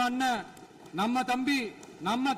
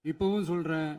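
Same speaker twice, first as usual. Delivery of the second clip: ordinary talk, nothing marked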